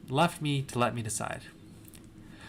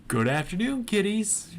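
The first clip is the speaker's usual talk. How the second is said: lightly voiced Southern show host, rising higher; southern accent; 8 semitones higher than usual. A narrator's reading. Freddie greets the kids from the stage, light southern accent. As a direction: greet a crowd of kids with a hint of Southern twang. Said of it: "in his southern accent".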